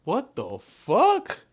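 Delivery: high, loud, slow disbelief; shocked outburst. Real, not acted. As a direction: drag it out in shock. Stunned reaction to the story.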